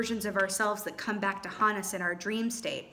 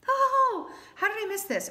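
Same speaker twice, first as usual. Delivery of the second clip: surprised; 12 semitones higher, 4 dB louder than usual. Real, not acted. Sudden discovery in the cards.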